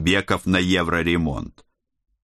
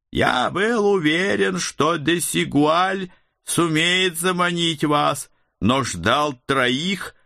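sinister necromancer; 8 semitones higher, slower than usual. A narrator's reading. Hooded necromancer speaks to heroes.

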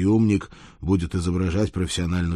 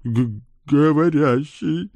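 slow, stammering, much higher gasp; stunned stammer; 8 semitones higher, 4 dB louder, slower than usual. Acted. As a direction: stammer in disbelief. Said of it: "обалдел паренёк".

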